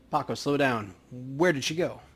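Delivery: ordinary delivery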